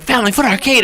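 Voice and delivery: high-pitched imitation of an excited fan; gushing, high-voiced mock excitement; mock excited outburst. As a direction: mimic a friend thrilled to spot an old favorite. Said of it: "you're like".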